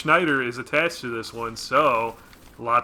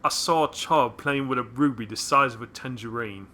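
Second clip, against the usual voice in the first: Michael Caine voice. Retold or imitated. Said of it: "that was very Michael Caine".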